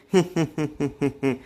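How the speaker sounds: a short jeering laugh at a friend's expense; mocking laugh. Chintu laughing at his drunk joke, mocking laugh. Joking about marrying the sister.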